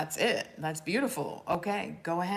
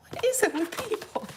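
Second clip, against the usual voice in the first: much higher and louder, incredulous; outraged disbelief; 5 dB louder than usual. Retold or imitated. She retells her reaction to an elder's cruel remark. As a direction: throw up your hands in disbelief. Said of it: "I was like".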